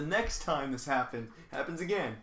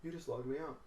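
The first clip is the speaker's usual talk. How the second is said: under the breath, much softer; muttered retort; 9 dB quieter than usual. Retold or imitated. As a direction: barely voice it, stunned. Stunned retort to coworker, muttered.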